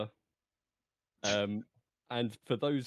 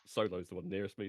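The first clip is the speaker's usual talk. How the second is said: ordinary talk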